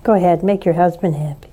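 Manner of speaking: ordinary talk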